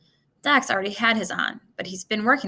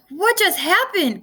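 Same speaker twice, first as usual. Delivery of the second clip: shouting; 9 semitones higher, 4 dB louder, slower than usual. A narrator's reading. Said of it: "Carter shouted".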